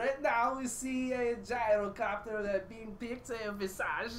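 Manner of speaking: slow, lightly put-on Kazakh-style accent; a light Borat accent, a bit slow. Co-caster asks for Borat, casting picks in a light Borat accent. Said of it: "can you do Borat?".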